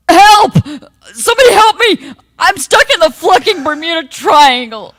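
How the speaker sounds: high, loud panicked scream; screaming for help. Acted. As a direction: cry out in terror.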